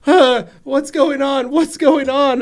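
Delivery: put-on high, slow, dazed child voice; groggy kid. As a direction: sound half asleep and confused. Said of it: "he's like".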